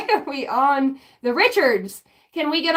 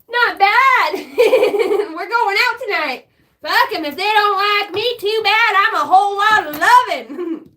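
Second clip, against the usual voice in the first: cocky self-hype; 6 semitones higher, 5 dB louder than usual. Pretend.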